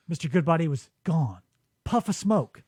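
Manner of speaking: normal talking voice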